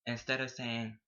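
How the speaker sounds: normal talking voice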